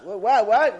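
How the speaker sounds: stammered, loud bewildered questions; acted startled confusion, tripping over the question; baffled questioning. Man hearing of the destruction, baffled.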